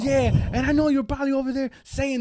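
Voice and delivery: high, indignant voice, volume held back; mock ranting tirade; imitated angry rant. Retold rant continues.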